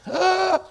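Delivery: sharp high mock gasp at himself; playful gasp. Pastor owning up to his choice of translation with a mock gasp. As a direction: gasp as if caught doing something naughty.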